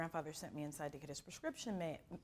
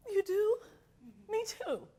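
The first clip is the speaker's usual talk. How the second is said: tearful reply; 13 semitones higher, 10 dB louder than usual. Retold or imitated. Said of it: "she goes from".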